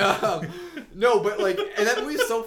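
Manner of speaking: laughing through words. Real, not acted. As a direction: laugh while talking. Laughing at the movie.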